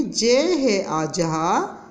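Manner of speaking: drawn-out sound blending; slow spelling out. Shown as a demonstration. Teacher blending sounds for young pupils, very slow. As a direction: slowly piece the word together. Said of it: "एक बार फिर मिला के पढ़िए".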